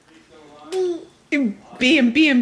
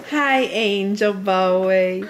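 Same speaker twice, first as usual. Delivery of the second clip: ordinary talk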